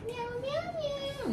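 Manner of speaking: high, soft meowing like a kitten; cat meow. Pretend. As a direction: mew softly like a cat. Playful cat noise.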